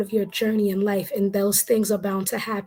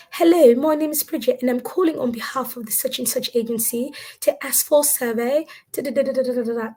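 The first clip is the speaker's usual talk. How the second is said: higher-pitched put-on British accent; 4 semitones higher than usual. Survey caller Bridget calling on behalf of an agency, British accent. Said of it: "Then I did my British accent".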